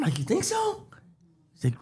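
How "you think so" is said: squeaky, very high unsure self; high, timid little question. Retold or imitated. Friend says he can be comedian.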